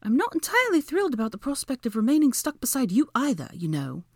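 high-pitched, prickly woman; irritated woman's retort. A narrator's reading. Woman stuck beside her rival, irritated retort. Hermione voiced bickering with Draco.

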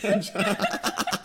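high, giggling apology; laughing. Real, not acted. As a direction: laugh out the words. Laughing while backpedaling.

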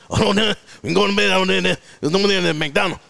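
garbled Cajun directions, last word clear; mock Cajun accent. Cajun local giving directions to a comic, garbled Cajun accent. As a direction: mumble it like a thick Cajun local. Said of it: "the Cajun folks over there".

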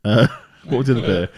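normal talking voice

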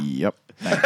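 very low, minimal deadpan answer; low, uninterested mumbled impersonation; Mike Dominguez. Acted. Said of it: "Here's my impersonation of Mike Dominguez".

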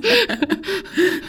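high-pitched giggle; helpless little high laugh; giggling. Real, not acted. Giggling at the name.

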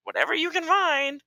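high, sing-song imitation of a brush-off; mock dismissive partner. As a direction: parody her curt reply. Mimics partner again.

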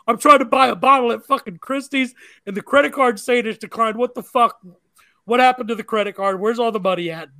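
freaking out at his wife over money; mock panic. Retold panicked text to wife.

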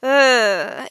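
loud, disgusted "ugh"; cringing ugh. Acted. Customer hearing her name said wrong, cringing groan.

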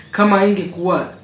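ordinary talk, nothing marked

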